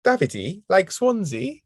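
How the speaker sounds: Welsh lilt on the name; Welsh accent. Retold or imitated. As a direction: say Davide the Welsh way. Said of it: "how good that accent was".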